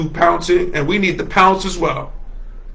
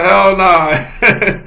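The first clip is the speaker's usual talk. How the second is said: a light hint of a sassy old auntie; Miss Sophia voice. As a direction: toss it off like a fed-up matriarch. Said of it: "In my Miss Sophia voice".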